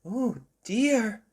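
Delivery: a mild worried mum; Mummy Pig voice. Acted. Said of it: "said Mummy Pig".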